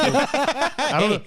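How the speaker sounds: high, slow, dissolving into real laughter; laughing. Real, not acted. Stuck mid-story about the businessman, laughing. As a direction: giggle the words out.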